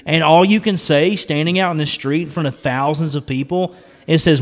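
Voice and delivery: ordinary talk, nothing marked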